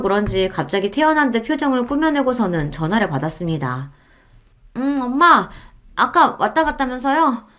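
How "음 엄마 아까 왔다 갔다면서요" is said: higher, cheerful phone greeting; bright voice. Acted. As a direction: put on a sunny tone for mom. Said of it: "밝은 목소리로". (밝게 꾸민 목소리)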